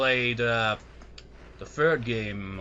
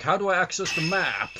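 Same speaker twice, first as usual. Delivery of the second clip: higher-pitched, mock-baffled question; mock confusion; 6 semitones higher, 4 dB louder than usual. Player acting out being lost without the instructions, mock-baffled. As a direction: play the clueless player asking. Imagined puzzled self asking.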